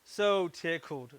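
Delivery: slow, savoring "so tickled"; drawn-out so tickled. Real, not acted. Maker delighted at his finished wedding wands, slow.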